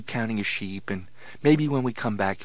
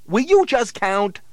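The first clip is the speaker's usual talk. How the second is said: slow, drawn-out exasperation; losing patience with a pest; exasperated; 10 semitones higher, 10 dB louder, slower than usual. Acted. Steve fed up with Harry.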